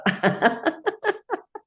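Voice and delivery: high-pitched burst of laughter; high giggling laugh. Real, not acted. Laughter session leader laughing.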